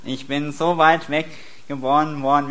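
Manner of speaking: usual speaking voice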